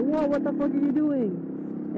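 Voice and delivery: high, slow, shocked whoa; startled yell. Retold or imitated. After a car nearly hit him, shocked and high. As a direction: relive the near miss, high and stretched. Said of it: "And I was like".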